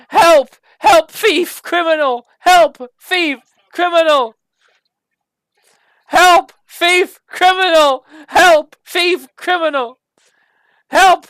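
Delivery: endless very high, very loud cries of help and thief; wailing alarm. Acted. The staff wails on and on at the players, very high and loud. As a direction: wail like a siren that won't stop. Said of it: "it wails there, going".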